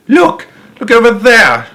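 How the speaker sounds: high shrill-free loud cry of discovery; screaming. Acted. Veruca screaming to everyone across the river, loud and high. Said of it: "she screamed".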